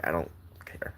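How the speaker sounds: breathy near-silent "I don't care"; whispered resignation. Real, not acted. Grandson steeling himself to tell a painful story, almost whispered. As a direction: say it under your breath, giving in. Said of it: "I hate this story to death".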